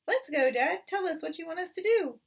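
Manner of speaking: high, loud rallying cry; eager cubs. Acted. Cubs urging father to start digging, high and loud. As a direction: fire him up like keen kids.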